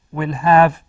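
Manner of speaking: will have, punched out loud; stressed will have. Shown as a demonstration. Teacher stressing will have for the class. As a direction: hit will have hard so the class hears it. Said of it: "Will have been working".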